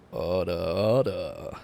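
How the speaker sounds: low, slow gavel call; deep judge voice. Pretend. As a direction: boom it like a judge in court. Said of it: "That was actually pretty good".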